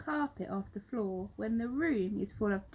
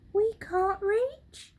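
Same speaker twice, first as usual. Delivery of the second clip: higher, lightly voiced anxious question; worried helpers; 11 semitones higher, 6 dB louder, slower than usual. Helpers doubting they can reach, mildly anxious. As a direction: ask it unsure. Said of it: "they asked".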